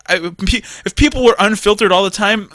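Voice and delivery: usual speaking voice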